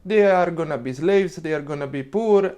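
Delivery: ordinary delivery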